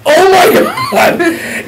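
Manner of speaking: long, drawn-out high yell; overwhelmed outcry. Retold or imitated. Man with pounding head being yelled at, wailing loudly. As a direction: wail it loud and slow.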